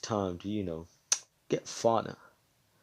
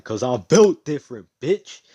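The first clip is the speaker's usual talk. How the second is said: cocky boast; 3 semitones higher, 11 dB louder than usual. Acted. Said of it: "Asta's just like".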